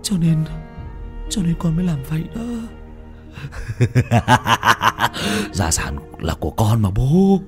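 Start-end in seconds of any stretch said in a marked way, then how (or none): 3.4-5.5 a mocking villain's laugh; evil laugh. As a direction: laugh coldly at the dead father. Villain laughs after confession.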